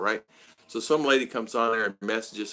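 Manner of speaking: ordinary talk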